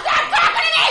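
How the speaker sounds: very high, loud screech; a shrieking yell; furious scream. Retold or imitated. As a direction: scream her silent. Yelling at birth coach.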